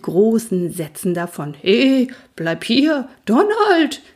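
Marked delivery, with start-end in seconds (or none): 1.6-3.1 slow, upset shout after him; upset whinnying call. Acted. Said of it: "wieherte Cavalier aufgebracht". 3.2-4.0 Donald called out much higher and louder, falling off long; upset call. Acted. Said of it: "wieherte Cavalier aufgebracht".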